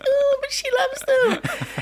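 very high, drawn-out coo; gushing auntie. Retold or imitated. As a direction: coo it slowly in mock-innocent defence. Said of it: "I'm like".

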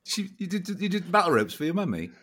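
ordinary talk

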